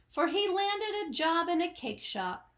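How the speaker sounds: ordinary delivery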